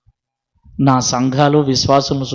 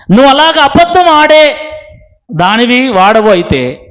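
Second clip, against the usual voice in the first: fiery sermon rebuke; 9 semitones higher, 4 dB louder than usual. Real, not acted. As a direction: thunder at the hypocrite from the pulpit. Preaching against lying. (ఆగ్రహంతో ఉపదేశం)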